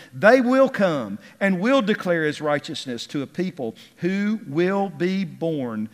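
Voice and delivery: ringing, forceful psalm recitation; exultant scripture reading. Acted. Reads praise verses at full force.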